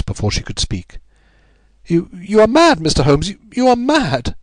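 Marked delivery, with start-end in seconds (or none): 1.8-4.3 high-pitched, stammering outburst of denial; distraught cry. A narrator's reading. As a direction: cry out high, stumbling on the first word. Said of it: "she cried at last".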